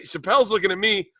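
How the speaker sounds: ordinary talk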